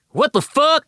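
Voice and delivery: high, startled Texan twang; Texas drawl. A narrator's reading. The little man snapping at his attacker in a Texas drawl. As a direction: blurt it out in a broad Texas drawl. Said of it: "in a Texas drawl".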